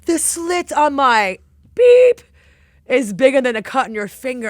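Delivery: chef's loud slow mock scolding of a cook; imitating Gordon's roar. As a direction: boom it out like a chef mocking a tiny cut. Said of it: "Gordon grabbed his finger and was like".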